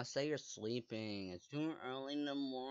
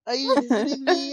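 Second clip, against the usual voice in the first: much higher and louder, gleeful; excited shout; 13 semitones higher, 17 dB louder than usual. Real, not acted. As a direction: squeal it with delight. Excited at friend's visit.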